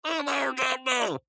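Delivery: high, held-out mock greeting; mimicked catchphrase. Shown as a demonstration. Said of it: "You mean like".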